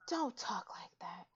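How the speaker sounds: slow, hushed mock reprimand; whispered scolding. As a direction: imitate a scandalized friend, whispering slowly. Voicing Charlotte's hushed scolding.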